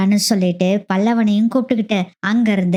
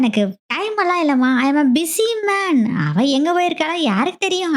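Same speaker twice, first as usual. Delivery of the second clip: mock self-important uncle, pitched higher; dismissive retort; 5 semitones higher than usual. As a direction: brush her off in an acted, higher voice. Uncle brushes off Nila's question. (அலட்சியமா பேசுற மாமா போல)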